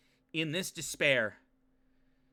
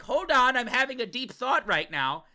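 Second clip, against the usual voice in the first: mock Yuki; 6 semitones higher, 4 dB louder than usual. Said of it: "then he's like".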